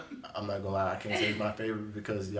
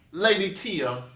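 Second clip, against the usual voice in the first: drawn-out, louder attempt at British; mock British accent; 9 semitones higher, 7 dB louder, much slower than usual. Host introducing his co-host, in mock British. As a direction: announce it slowly in a put-on British accent. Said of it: "how you say it in British".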